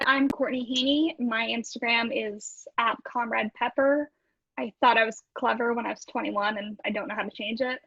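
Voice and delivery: ordinary delivery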